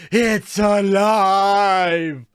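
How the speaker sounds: loud, drawn-out cry with every word stretched; mad triumphant shout. Acted. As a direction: shout it slowly like a mad inventor whose creation works. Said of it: "He probably immediately started shouting".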